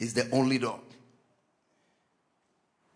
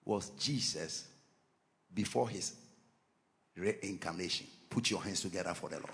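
much lower and much softer, far off; faded quiet; 12 semitones lower, 19 dB quieter than usual. Real, not acted. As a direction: let the voice drop right away. Voice drops away, far.

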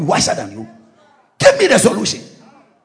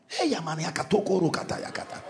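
whispered mock tongues; 4 semitones lower, 14 dB quieter than usual.

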